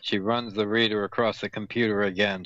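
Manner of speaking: ordinary delivery, nothing marked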